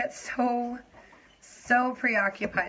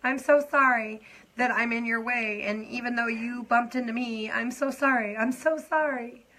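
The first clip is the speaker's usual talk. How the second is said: higher, pleading run of apologies; over-apologetic past self; 5 semitones higher, 3 dB louder than usual. Retold or imitated. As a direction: mimic a constant apologizer. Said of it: "I was, I was".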